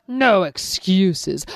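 slow, heavy lion command; lion silencing rabbit, slow and stern. Acted. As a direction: lay it down slowly, no arguing.

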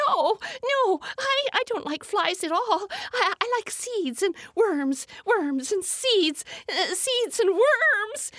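high, fast, stammering bird voice; a fluttery, flustered little bird. Acted.

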